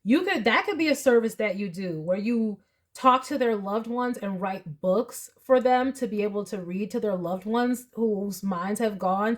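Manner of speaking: usual speaking voice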